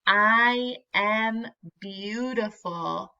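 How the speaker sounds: slow, drawn out, ending on a gentle rise; slow and proud. Shown as a demonstration. Teacher repeating the affirmation with the class, slow and proud. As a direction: lead the repeat slowly for the kids to follow. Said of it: "Let's say it again".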